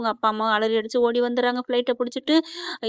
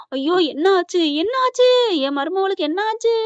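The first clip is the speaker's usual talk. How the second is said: high, rising mock wail; hammed-up high-pitched lament; imitated lamenting; 7 semitones higher, 4 dB louder than usual. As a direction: wail in distress as a retold mother-in-law, pitched way up. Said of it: "அப்படின்னு சொல்லிட்டு ஈஸ்வரியா புலம்ப".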